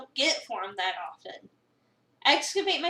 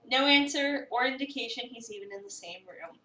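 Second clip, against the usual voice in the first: ordinary talk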